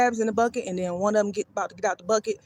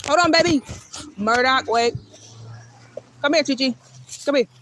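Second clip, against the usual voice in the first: raised voice to the running dog; calling out; 3 semitones higher than usual. Real, not acted. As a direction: call after him across the street.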